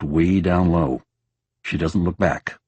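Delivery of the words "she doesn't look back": ordinary delivery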